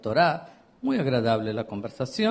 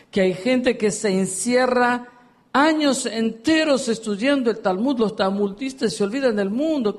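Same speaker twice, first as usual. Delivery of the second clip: impassioned preaching; 9 semitones higher, 5 dB louder than usual. Acted. Preaching at full force.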